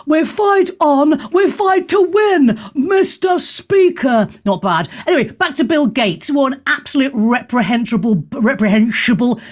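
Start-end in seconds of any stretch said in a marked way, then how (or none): 0.1-4.3 slow, higher, grand prime-minister delivery; stately mock Thatcher speech; Margaret Thatcher. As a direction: drag each phrase out like a defiant leader. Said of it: "I do quite a good Margaret Thatcher".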